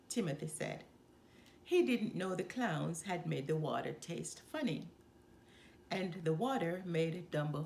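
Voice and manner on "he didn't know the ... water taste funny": ordinary delivery, nothing marked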